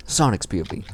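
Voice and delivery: ordinary talk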